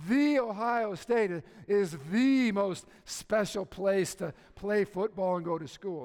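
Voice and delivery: loud, slow, puffed-up proclamation; cocky boast, mocked. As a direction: hammer each "the" like an insufferable alum. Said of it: "they're cocky guys about that stuff".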